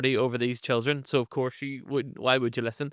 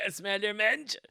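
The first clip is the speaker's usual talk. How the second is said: laughing jeer; 8 semitones higher, 5 dB quieter, slower than usual. Retold or imitated.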